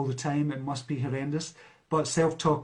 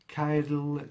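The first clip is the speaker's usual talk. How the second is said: ordinary talk, nothing marked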